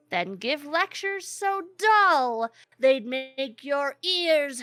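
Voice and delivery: slow, high gnome reciting verse; gnome reciting a poem. Acted. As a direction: recite it slowly like a smug gnome poet. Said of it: "the gnome goes".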